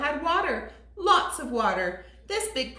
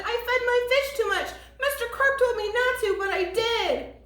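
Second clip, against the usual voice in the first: put-on panicked boy voice; 11 semitones higher than usual.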